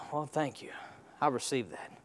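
very soft, low acted reply; hushed, low mock thank-you; meek acted reply. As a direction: drop right down, playing a quietly grateful listener. Acting a listener's meek reply.

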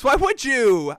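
ordinary talk

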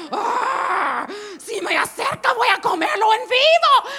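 loud, high monster growl; dinosaur roar. Retold or imitated. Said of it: "estoy como el parque jurásico". (rugido de dinosaurio furioso)

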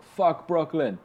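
rough impression of his accent; Masai Ujiri. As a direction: attempt an accent, not very well. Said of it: "your Conor McGregor accent sounds a lot like your Masai Ujiri".

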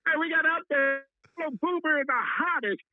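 high, excited DJ calls; hyped announcer shout. Acted. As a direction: shout it like a hype man. Hyped radio shout-outs.

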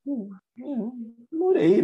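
high, coy, teasing hum before the answer; playfully modest reply to a surprised student. Retold or imitated. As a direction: tease them with a coy high morei. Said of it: "meio não falando, né, mas brincando".

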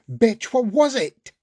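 high, loud, slow shout; raging demand at Charlotte; furious shout. A narrator's reading. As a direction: scream the question at her. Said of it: "He's yelling, shouting".

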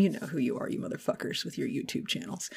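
hushed unvoiced threat; menacing whisper. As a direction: whisper it like a warning. Low menacing aside to trolls.

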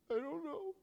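high, put-on little kid voice; child's reply. Child answering dad before a spanking, high small voice. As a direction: imitate a reluctant small child.

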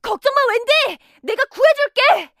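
high, loud reassuring call; heroic shout. Acted. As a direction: shout back bravely. Peter calls to captured Wendy.

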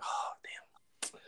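breathless whispered oh damn; whispered dread. Retold or imitated. Tired freshman muttering to himself under the coaches' yells, whispered dread. Exhausted runner reacting to coaches.

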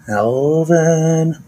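name called out high and loud; excited shout-out. Acted. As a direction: call the name out like a cheer. Shouting out friends' names.

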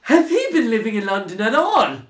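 high, loud mock mum voice; imitating mum. Mum meeting the Cork housemate, high mocking voice. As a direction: squeak it out like a scandalised Irish mammy. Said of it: "she was like".

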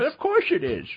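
high, loud goofy whine of Adam Sandler; mock Sandler voice. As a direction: squeal it like a silly Sandler character. Mocking Adam Sandler voice joke.

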